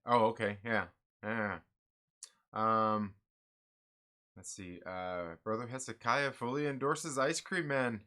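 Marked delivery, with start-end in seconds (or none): none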